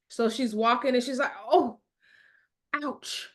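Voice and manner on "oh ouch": mild mock moan of pain. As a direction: act out a little pained "oh". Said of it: "So she is moaning".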